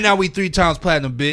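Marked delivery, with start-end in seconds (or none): none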